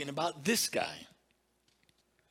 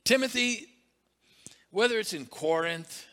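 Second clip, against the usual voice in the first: emphatic call; 7 semitones higher, 4 dB louder than usual. Acted.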